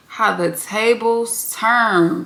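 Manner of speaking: slow, drawn-out delivery; slow ominous aside. Acted. Host drawing the moral of the drama, slowly. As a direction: savor each word slowly.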